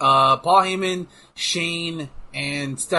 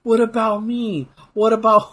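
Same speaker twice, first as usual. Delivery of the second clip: hammed-up pleading, pitched way up; mock plaintive catchphrase; 11 semitones higher than usual. Quoting a favourite wrestling line to a friend, mock plaintive wail.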